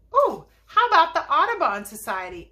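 high, loud, eager little voice; acted little fellow calling out a suggestion. A narrator's reading. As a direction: pipe up with a sudden idea. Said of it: "asked a little fellow".

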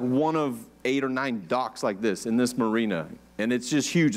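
normal talking voice

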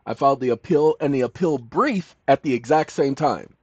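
ordinary talk

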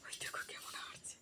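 unvoiced hushed whisper. Real, not acted. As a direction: whisper so the pet won't notice. Whispering while watching pet. (ফিসফিস করে)

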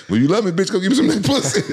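half-laughing bravado; laughing inner voice. Retold or imitated. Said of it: "I was thinking about".

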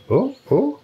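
high surprised yelps. Real, not acted. Ball bounces unexpectedly.

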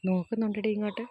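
soft, hushed remarks; quietly telling a friend the goat is looking. Real, not acted. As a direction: keep your voice down, it's watching. Quietly watching goats nearby.

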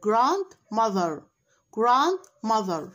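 very slow, clear teacher model; slow model pronunciation. Shown as a demonstration. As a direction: stretch it out clearly. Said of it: "نوضح اللي هو التي اتش ازاي بيتنطق".

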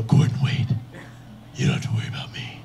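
hoarse, whispered heroic speech; Batman. Acted. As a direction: deliver a solemn speech in a raspy whisper. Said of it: "make a big Batman speech".

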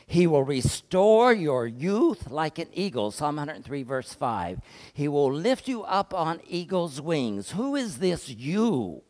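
loud, slow pulpit delivery; full-force preaching. Acted. Pastor preaching to the congregation, loud and slow. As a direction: proclaim it to the whole church.

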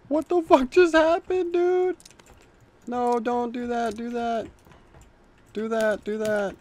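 mock squeaky panic voice; squeaky whiny voice. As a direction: whine it like a panicky little kid.

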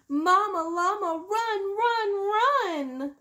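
high, loud crying call to run; crying plea. Acted. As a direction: beg through tears for mama to come. Baby calls for mama.

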